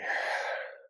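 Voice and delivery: long, voiceless exhale; demonstrated releasing breath; clearing sigh. Shown as a demonstration. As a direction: sigh it all out through the mouth. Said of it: "And a good clearing sigh".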